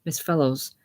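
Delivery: normal talking voice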